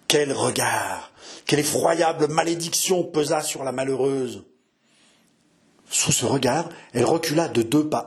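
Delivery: plain reading voice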